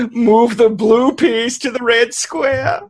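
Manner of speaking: high, slow, drawn-out sing-song; hammed-up, piercing game character; mock game-master voice. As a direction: call out game moves in a silly high voice. Imitates the game's high chanting character.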